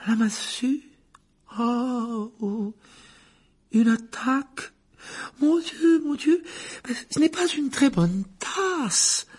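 high, slow, theatrical seer with a tragic tremble; a dramatic fortune-teller moaning over the cup; Trelawney voice. Acted. The divination teacher warns Harry, high and theatrical.

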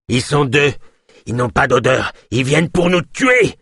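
high warning building to a cry; rising panic in a character voice; alarmed warning. A narrator's reading. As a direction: start urgent, end on a high shout. Yosp warns, building to a cry.

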